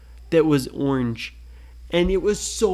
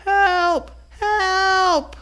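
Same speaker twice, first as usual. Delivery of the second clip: much higher, louder squeaky help; high cry for help; 5 dB louder than usual. Acted. Ryan stuck in an ant pit, squeaking for help. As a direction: cry out small and scared.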